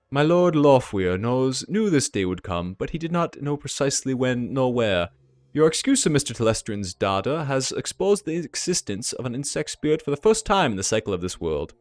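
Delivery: put-on stately German-accented envoy. Dragon's envoy tells what his lord knew, measured German accent. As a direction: start slowly and weightily in a German accent. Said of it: "deep, melodious German accent".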